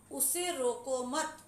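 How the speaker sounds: slow रोको मत kept together, then a pause; pause after मत. Shown as a demonstration. Teacher demonstrating comma placement, pause after मत. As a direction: hold the comma until after मत. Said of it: "एक जगह मत के बाद कॉमा है".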